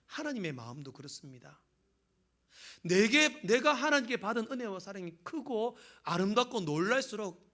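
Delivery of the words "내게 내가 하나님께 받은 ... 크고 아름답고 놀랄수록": loud high sermon build into praise; fervent preaching into a grateful prayer; preaching at full force. Acted. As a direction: cry out thanks to God from the pulpit.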